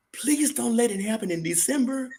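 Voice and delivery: high-pitched pleading prayer; imitating an earnest request in prayer; retold plea. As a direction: plead upward to the Lord, retelling it. Retold prayer begging the Lord.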